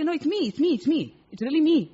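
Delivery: insisting caller. Retold or imitated. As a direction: reassure a doubting caller, pressing the point. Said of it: "You say".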